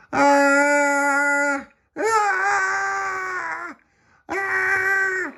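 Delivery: long, piercingly high screams; acted screams. A narrator's reading. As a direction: scream high from the swaying mast. Tossed atop the mast.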